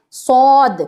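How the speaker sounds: صاد full, thick and heavy. Shown as a demonstration. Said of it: "موٹا کر لینا ہے". (موٹی بھاری آواز میں صاد)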